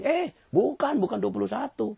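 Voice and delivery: high, loud nasal-free squeak of protest; mocking voice of a stubborn man insisting; stubborn objector. Stubborn man disputes the sum.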